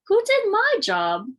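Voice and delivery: put-on a kid demanding answers; Prim asking. A narrator's reading. Sister Prim asking who did her job, high and loud. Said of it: "asked Prim".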